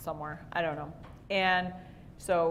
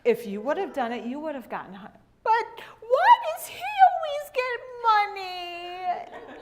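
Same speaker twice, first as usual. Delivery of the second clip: crying whine; 10 semitones higher, 5 dB louder than usual. Retold or imitated. Daughter upset about money.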